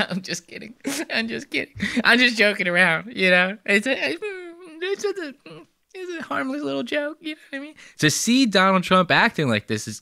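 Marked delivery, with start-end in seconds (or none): 0.1-3.6 higher, mumbled "just kidding" with a nervous laugh; awkward nervous joke. Retold or imitated. Vlogger mimics Trump brushing off an awkward moment, nervous higher laugh. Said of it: "he's like". 3.6-7.7 very high, soft squeaky voice; nervous squeaky excuse. Retold or imitated. Vlogger mimics Trump's awkward excuse in a very high squeaky voice.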